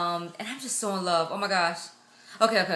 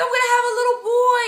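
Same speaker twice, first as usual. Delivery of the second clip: very high, loud, fast squeal of joy; so excited; 8 dB louder, faster than usual. Retold or imitated. Mother-to-be retelling her joy at having a boy, so excited. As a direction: squeal it out, bursting. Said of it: "I just got so excited".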